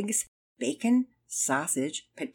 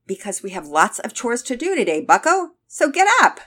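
loud, higher-pitched order building to a shout; rousing dad; 6 semitones higher, 10 dB louder than usual. A narrator's reading. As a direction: push a sleepy kid out of bed, louder and louder. Dad briskly rousing a reluctant son.

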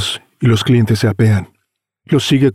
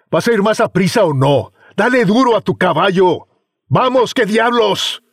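escalating comedy rant; 10 semitones higher than usual. Acted. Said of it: "grita enojado".

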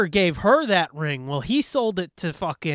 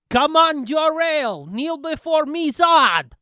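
high, loud mocking villain challenge; mock villain taunt; 9 semitones higher, 4 dB louder, slower than usual. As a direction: ham up a sneering comic villain. Quoting Zod villain line.

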